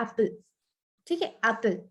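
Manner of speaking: "एप्पल" with a short clipped first vowel. Shown as a demonstration. Teacher demonstrating a short vowel. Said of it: "ए word आ रहा है वो बिल्कुल छोटा सा".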